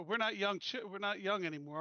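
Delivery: normal talking voice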